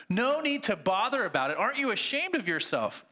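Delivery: high, aggrieved complaining ghost; indignant ghost. Acted. Sermon reading, the big ghost protests to the bright spirit, high indignant voice. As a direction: whine it like a wronged tough.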